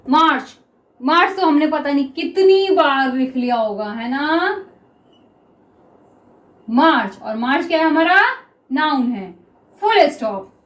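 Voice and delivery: normal talking voice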